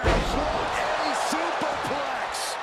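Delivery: excited call. Acted. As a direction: lean into the big move. Commentator calls a big move.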